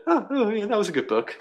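lightly acted, high-pitched "oh yeah"; mock approval. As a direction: play a quick, pleased first reaction. Voicing own first casual reaction.

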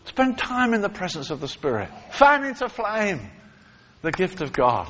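fervent preaching. Acted. Exhorting the congregation.

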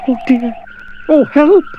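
very high, loud panicked cry; frightened cry for help. Acted. Jack falling toward the crocodile's mouth, panicked high cry.

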